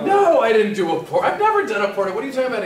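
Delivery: high, loud, indignant protest; shocked denial. Retold or imitated. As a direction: deny it in comic alarm. Shocked denial of mentee's accusation.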